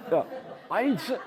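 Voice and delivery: high, loud, laughing denial; giggling self-defense; laughing protest. Retold or imitated. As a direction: squeal it out through laughter. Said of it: "And I'm like".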